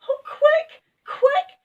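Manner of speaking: very high, loud frightened cry; panic-stricken call; Fanny shouting. A narrator's reading. Fanny shouts as the land moves, very high and loud. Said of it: "shouted Fanny".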